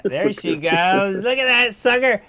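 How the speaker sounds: slow, rising squeal of delight at a pet; high-pitched doting voice. Real, not acted. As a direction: gush loudly up high. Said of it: "She likes the high-pitched voice".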